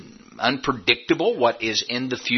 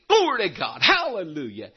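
high, whooping hallelujah, falling away; mock revival fervor; 5 semitones higher than usual. Preacher mimics the congregation at offering time, mock revival fervor. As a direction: imitate worshippers coming unglued. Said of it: "coming unglued at offering time".

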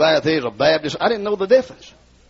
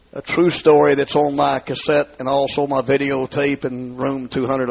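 usual speaking voice

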